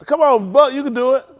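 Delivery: normal talking voice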